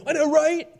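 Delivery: very high squeal of agreement; hammed-up, high-pitched gossipy delight; gleeful gossip reaction. Listener thrilled by juicy gossip, hammed-up high squeal.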